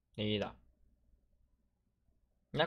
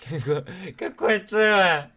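stunned outcry; 8 semitones higher, 8 dB louder, slower than usual. Real, not acted. Reacting to a weird clip.

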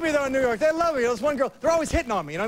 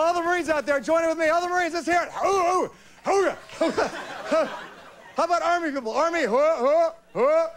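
crowd call; 5 semitones higher than usual. Acted. Calling out to Marines in crowd.

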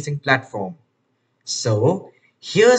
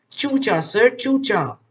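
higher, chirpy little mouse voice; tweeting mouse; 6 semitones higher than usual. A narrator's reading. Mouse introducing himself to the lion, high and chirpy. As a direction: chirp the name out brightly. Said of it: "tweeted Chucha".

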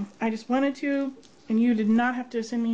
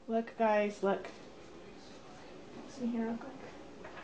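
usual speaking voice

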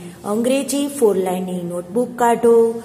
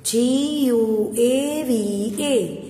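slow spelling; much slower than usual. Shown as a demonstration. Teacher repeating the guava spelling for her pupils. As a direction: repeat each letter gently for the class. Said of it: "spelling repeated".

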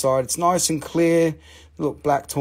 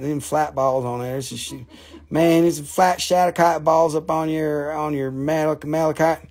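Southern-accented sales patter. Shown as a demonstration. Seller showing off malachite balls to viewers in a Southern accent. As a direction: keep up the Southern talk. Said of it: "I start talking like this".

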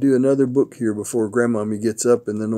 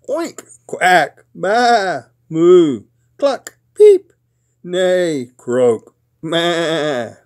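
animal noises; 4 semitones higher, 6 dB louder, much slower than usual. A narrator's reading. Animals scramble into the truck, noisy animal calls. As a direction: roll call of farm animal sounds, each voiced differently.

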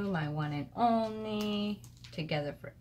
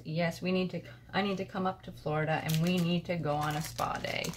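normal talking voice

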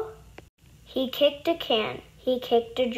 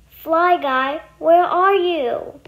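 calling out; 5 semitones higher, 5 dB louder, slower than usual. A narrator's reading. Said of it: "he cried".

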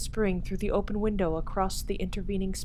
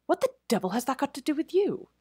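higher and fast, fiercely demanding; 9 semitones higher, faster than usual. Acted. Crutchley to the head waiter, fierce and angry. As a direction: snap back at the waiter in outrage. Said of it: "Crutchley demanded fiercely".

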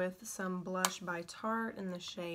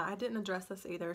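ordinary talk, nothing marked

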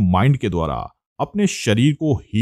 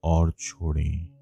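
guided meditation; 7 dB quieter than usual. Acted. (धीमी, शांत योग निद्रा निर्देश वाली आवाज़)

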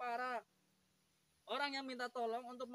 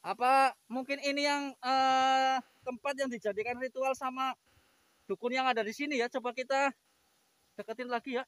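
raised voice against strong wind; shouting over wind; 3 semitones higher, 9 dB louder than usual. Real, not acted. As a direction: call out loud over gusts. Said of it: "ini anginnya besar banget". (berteriak melawan angin kencang)

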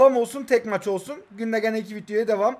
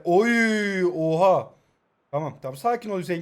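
ordinary delivery

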